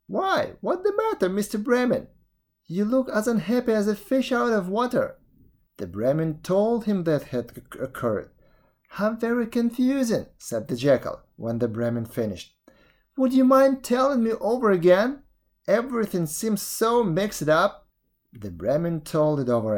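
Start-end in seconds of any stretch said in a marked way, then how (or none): 0.1-5.2 very high-pitched jackal voice; jackal calling out. A narrator's reading. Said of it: "The jackal called out". 8.9-10.3 very high, bewildered voice; puzzled jackal. Acted. 13.2-17.9 very high, confused voice; puzzled jackal. Acted. Jackal asks to hear again.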